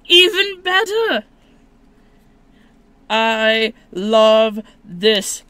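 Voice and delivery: loud, high, jubilant outburst; excited shouting. Real, not acted. Card opener pulling a favorite Pokemon, excited shouting. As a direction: shout thanks with overjoyed emphasis.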